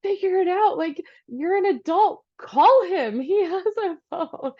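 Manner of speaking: high, emphatic exasperated orders; acted-out irritated telling-off; frustrated. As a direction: snap at a clueless husband, half laughing by the end. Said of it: "It's so frustrating".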